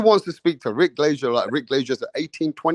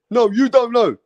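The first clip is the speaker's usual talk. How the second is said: higher, louder argumentative jab; mock heated retort; 6 semitones higher, 3 dB louder than usual. Imitating Mirage arguing with the host, mock heated retort. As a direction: snap back as the heckler.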